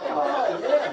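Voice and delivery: high squeezed "oh yeah" with a laugh; mock surprised. Imitating his surprised tight-capped toddler, squeaky and high. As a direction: squeak it out like a face frozen in surprise.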